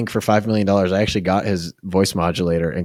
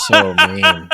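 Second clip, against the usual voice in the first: very high mock whine; mock offended fans. Co-host mimicking upset fans of a criticized hero, high mock whine. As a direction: squeal it like a wounded fan. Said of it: "and they go".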